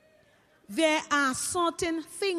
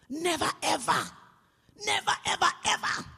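emphatic, climbing higher with each repeat; mock indignant warning; 5 semitones higher than usual. Flustered mother to debt caller, acted indignant warning. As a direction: hammer each word, acting the outraged mother.

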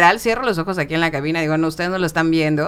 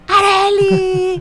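high, drawn-out calling of a name, slow; mock distant shout; 5 dB louder, much slower than usual. Unseen voice calling her awake, high drawn-out call. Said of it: "yo oía que me gritaban".

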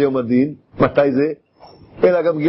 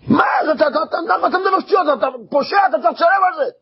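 furious accusation; 11 semitones higher, faster than usual. Acted. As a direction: call him a criminal, shouting. Homeowner accuses criminal. (האשמה זועמת בצעקה גבוהה)

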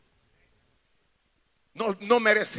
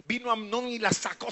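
normal talking voice

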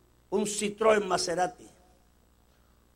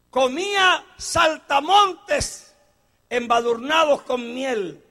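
preaching; 7 semitones higher, 8 dB louder than usual. Acted. Preaching at full force.

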